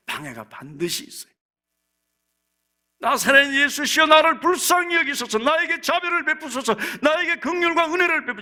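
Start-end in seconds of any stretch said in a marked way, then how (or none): 3.0-8.4 high, loud plea for mercy; beggar's loud, high-pitched appeal; crying out in prayer. Acted. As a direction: cry out urgently to be heard. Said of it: "이 지금 바디메오는 그렇게 부르짖고 있습니다".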